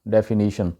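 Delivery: डेफिनेशन with "ni" in place of "nay"; correct pronunciation. Shown as a demonstration. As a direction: say डेफिनेशन as "def-i-NI-shun". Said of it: "सही pronunciation है".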